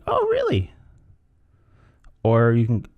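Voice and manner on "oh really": very high, fascinated lilt; intrigued. Shown as a demonstration. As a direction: sound keenly interested, way up high. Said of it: "if it's interesting".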